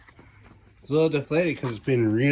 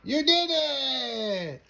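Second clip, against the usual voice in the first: delighted praise; 9 semitones higher, much slower than usual. Real, not acted. Toddler succeeds.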